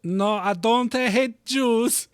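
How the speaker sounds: slow, hammy fake German accent; drawn-out mock German accent; weird German accent. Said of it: "You said that in a very weird German".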